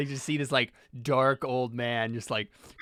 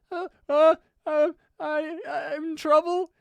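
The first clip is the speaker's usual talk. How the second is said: high, loud, whimpering stutter; terrified stammer; 5 dB louder than usual. Acted. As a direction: stammer in a squeaky, frightened voice. Paul answers the looming old man.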